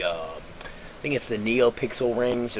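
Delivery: normal talking voice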